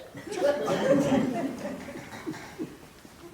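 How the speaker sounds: unvoiced giggling laugh; breathy laugh. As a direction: laugh out under your breath. Laugh after sarcastic remark.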